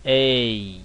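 A drawn out with a long, lengthened ending. Shown as a demonstration. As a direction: stretch the A, hold the tail of the vowel. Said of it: "尾音要拉长". (拉长尾音念"A")

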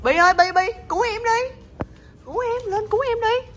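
high, pleading squeal; mock plea for help. Calling Bi to rescue her, high mock plea. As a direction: beg dramatically to be saved. Begging other pet for rescue.

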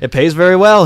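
lightly voiced eager professor; reassuring professor. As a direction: sell the job with eager assurance. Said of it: "he assured".

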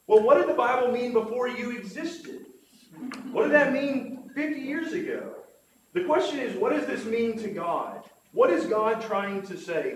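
high, loud rhetorical questions; pointed challenge. Retold or imitated. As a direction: press the quoted questions home, pitched high and loud. Quotes an author's challenging questions.

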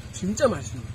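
talking while chewing; muffled with food in mouth; mouth full. Real, not acted. Seen 0: Man took a bite at -5; cheeks full, chewing while talking.

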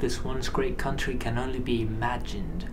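soft, grave trailer voice; mock-grim documentary narration; doom narration. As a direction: narrate the downfall like an epic tragedy.

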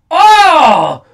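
shocked oh. Real, not acted. Reacting to chair shot.

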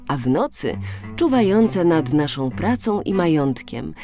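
plain reading voice

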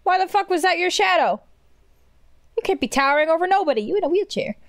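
high, fast incredulous rant; can't believe it, voice shooting up; high-pitched flustered disbelief; shocked. Real, not acted. Shocked at towering shadow.

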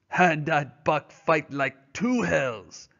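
slow, proud French-Canadian drawl; Francois answering Perrault about Buck, slow French-Canadian accent; Francois' French-Canadian accent. Acted. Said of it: "was Francois' answer".

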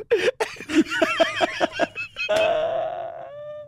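squealing burst of laughter; high-pitched laugh. Real, not acted. Big laugh after joke.

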